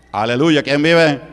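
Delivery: loud call to the congregation; rally the crowd with a shout; praise shout. Real, not acted. Pastor rousing the church, loud call.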